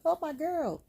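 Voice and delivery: high, loud delighted squeal; excited fan. Retold or imitated. Retelling seeing Bianca come out, excited squeal. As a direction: squeal with joy for your favorite.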